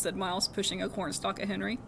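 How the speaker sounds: plain reading voice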